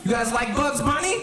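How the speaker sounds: high disbelieving challenge; incredulous question. Real, not acted. Challenging the crowd again.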